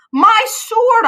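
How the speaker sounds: put-on old man demanding his sword; indignant demand. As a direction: insist on the sword. Old Capulet insisting.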